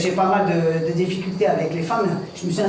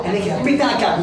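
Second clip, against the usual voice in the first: usual speaking voice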